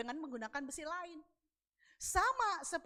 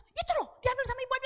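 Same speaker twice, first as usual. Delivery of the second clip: high, loud, mock scolding; retold angry mother; 12 semitones higher, 8 dB louder, faster than usual. Annoyed woman answering her child, high loud complaint. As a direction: snap it out high, imitating an irritated mother. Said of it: "Dia marah".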